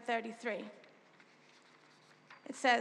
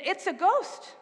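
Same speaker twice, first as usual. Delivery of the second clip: slightly raised, understated cry of fear; mild fearful cry; 6 semitones higher, slower than usual. A narrator's reading. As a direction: read it as a mild frightened exclamation. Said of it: "and cried out in fear".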